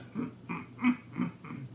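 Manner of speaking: grunt. Shown as a demonstration. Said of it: "How would they grunt?".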